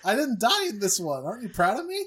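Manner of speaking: high, loud, gleeful cartoon voice; mock proud goon. Hosts joking about a minor Decepticon, high gleeful mock voice. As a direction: squeal it up like a proud bit-part robot calling home. Mocking a doomed character's joy.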